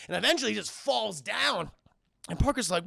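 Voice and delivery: normal talking voice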